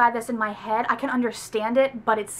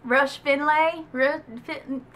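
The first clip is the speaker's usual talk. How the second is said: slow, faltering attempt at a Southern accent; bad Southern accent; 3 semitones higher, much slower than usual. Shown as a demonstration. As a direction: try a Southern drawl and give up. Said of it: "I cannot verbally give a Southern accent well".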